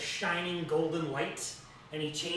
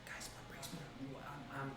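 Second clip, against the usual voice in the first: panicked hushed aside; whispering; 2 semitones lower, 15 dB quieter than usual. Acted. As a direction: mutter it to friends in panic. Rainier whispers to party.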